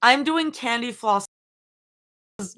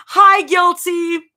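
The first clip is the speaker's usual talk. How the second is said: high, loud, drawn-out hello; real thrilled shout, stretched out; excited greeting shout; 10 semitones higher, 11 dB louder, slower than usual. Real, not acted. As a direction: squeal a delighted greeting to a friend. Streamer greets arriving viewer.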